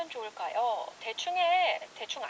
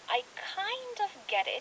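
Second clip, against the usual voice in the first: slow, higher teaching read; slow model phrase; 7 semitones higher, slower than usual. Shown as a demonstration. Host modeling the phrase slowly for listeners. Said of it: "대충 알겠어".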